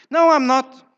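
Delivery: loud, indignant mock denial; angry denial. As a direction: snap back loudly, acting out someone denying their anger. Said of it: "very angry".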